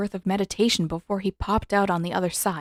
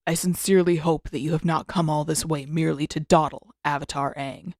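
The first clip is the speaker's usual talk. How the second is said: put-on lower, measured voice of an old master; a grumpy elder rebuking his student, lower; stern old Avatar Roku; 4 semitones lower than usual. Roku chides Aang for dawdling, in a lower stern voice. Roku's voice in story.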